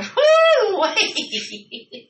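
high squealing woo, breaking into giggles; tipsy giggling. Retold or imitated. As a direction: lose it to the giggles. Drunk giggles at dinner.

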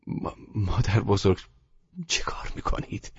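a nervous stammer, trailing to a whisper. A narrator's reading. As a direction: stumble over the words in dismay. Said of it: "من تته پته کنان گفتم".

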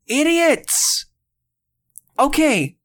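high-pitched mock-ranting cry; exasperated outburst. Host ranting at viewers who called it filler, exasperated. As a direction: call it out high, fed up with them. Retelling angry reaction to viewers' complaints.

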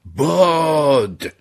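long, drawn-out high yell; loud, stretched cry of warning; shout. Acted. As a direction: bellow the word and hold it. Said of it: "یکی داد زد".

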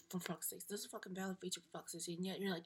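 ordinary delivery

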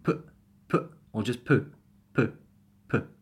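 "put" ending abruptly as pu'; glottal T. Shown as a demonstration. Teacher drilling words ending with T, glottal "put". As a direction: stop the end of "put" at the glottis. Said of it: "Just glottal it".

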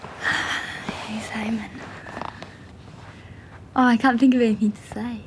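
sighing, unvoiced whisper to the camera. Real, not acted. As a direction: hush it like a shy confession. Shy aside to the camera.

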